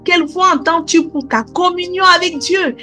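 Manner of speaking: ordinary talk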